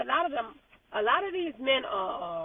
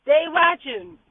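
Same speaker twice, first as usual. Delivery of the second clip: louder emphatic "They watching."; 2 semitones higher, 9 dB louder, slower than usual. Real, not acted. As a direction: repeat it louder, laughing.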